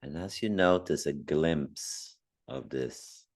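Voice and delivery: calm meditation guide with long pauses; unhurried meditation voice, phrase by phrase; guided meditation. Acted. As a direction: gently guide listeners inward, leaving long silences.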